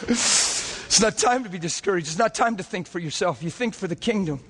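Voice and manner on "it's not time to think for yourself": ordinary talk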